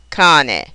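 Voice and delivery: "cane" as CA-ne; stress first syllable. Shown as a demonstration. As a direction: lean on the CA of "cane". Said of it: "accented on the first syllable".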